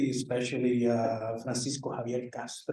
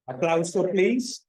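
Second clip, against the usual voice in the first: calling for applause; 5 semitones higher, 6 dB louder than usual. Real, not acted. Calls audience to applaud.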